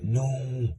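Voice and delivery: retold cry of protest; imitated cry of no. Acting out Will's dismayed reaction.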